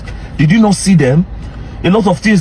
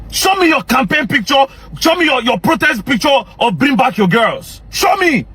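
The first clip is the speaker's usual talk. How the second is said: higher, building to a shouted SHOW ME; angry demand; 5 semitones higher than usual. Real, not acted. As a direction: push harder each time until you yell. Demanding proof from critic.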